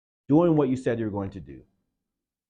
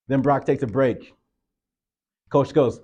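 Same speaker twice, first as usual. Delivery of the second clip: normal talking voice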